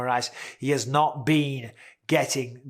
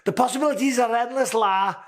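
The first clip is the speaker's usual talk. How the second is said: put-on much higher, louder Scouse accent; Darren Till accent; 8 semitones higher, 3 dB louder than usual. Imitating friend Darren Till's title boast, hammed-up Scouse accent. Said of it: "we'll do a Darren Till accent".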